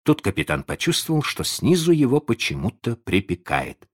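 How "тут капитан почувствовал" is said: ordinary delivery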